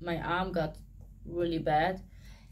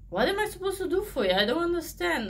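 higher and fast, at a loss; pitched-up confused pleading; bewildered plea; 10 semitones higher, faster than usual. Retold or imitated. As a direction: beg for an answer you can't find. Retelling her plea to Jesus.

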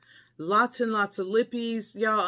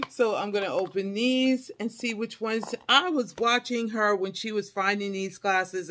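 ordinary talk

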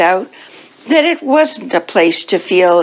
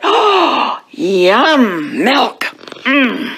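high gasp into relishing yum; delighted savouring; 5 semitones higher, much slower than usual. Acted. Cats seeing the milk, delighted and savouring. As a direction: savour each word with drawn-out delight.

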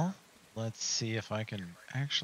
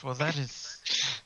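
haughty lord; 4 semitones higher, 4 dB louder than usual. Acted. Lord voiced in the story.

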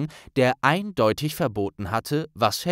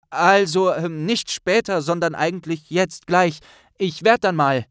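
higher, louder nervous stutter; 6 semitones higher, 5 dB louder than usual. Acted. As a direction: fumble for an excuse. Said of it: "stotterte Neville". (nervös stotternd, höher und lauter)

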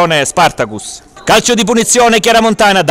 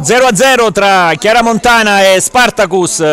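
usual speaking voice

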